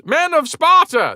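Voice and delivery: high, loud, slow herald call; formal address. Retold or imitated. Messenger addressing the men of Sparta, grand and slow. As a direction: proclaim it to the assembly. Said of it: "the message ran".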